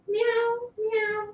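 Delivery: high, soft meowing like the cat; cat meow imitation. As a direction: mimic the kitty's meow at the door.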